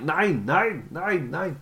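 high mock-German refusals; hammed-up, high-pitched German; mock German accent. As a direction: repeat it in a comic German accent. Joking German accent, repeated nein.

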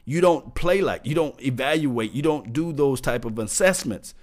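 ordinary talk, nothing marked

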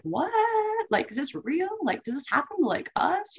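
high, hushed voice of someone who can't believe it; stunned disbelief. Retold or imitated. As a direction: ask it as if the news can't be real. Retelling her own stunned disbelief.

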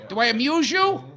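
high-pitched touchy wiseguy challenge; mock-menacing mobster impression; Joe Pesci. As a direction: play an affronted movie gangster, pitch pushed up. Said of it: "It's like Joe Pesci in Goodfellas".

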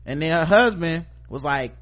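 normal talking voice

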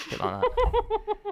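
very high squeaky dolphin whistle; dolphin impression. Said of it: "do a dolphin".

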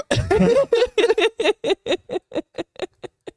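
high breathless laugh; real helpless shrieking laugh; squealing laugh. Real, not acted. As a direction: lose it completely. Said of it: "why am I laughing so hard".

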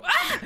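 Muscle Man scream. Shown as a demonstration. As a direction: yelp it out as if hit on the arm. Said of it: "that stupid voice".

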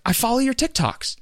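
high-pitched imitation of an excited fan; kid's mom. Kid's mom recognizing a TikToker, high-pitched. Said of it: "the kid's mom".

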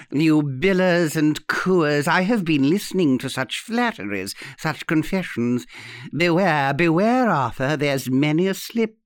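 put-on teasing old lady, melodramatic warning; horrible old aunt. Aunt warning the young lovers, archly melodramatic. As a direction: swell to a playful beware. Aunt's voice in the story.

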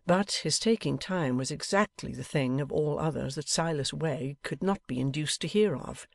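ordinary delivery

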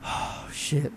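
breathy unvoiced whisper; hushed panic whisper; whispered oh shit. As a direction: whisper it like you just got caught. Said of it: "you're like".

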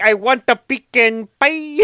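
high, silly put-on movie voice; mimicking voice. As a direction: parrot it in a comic high voice. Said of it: "talking like this and saying".

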